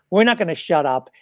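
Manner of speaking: normal talking voice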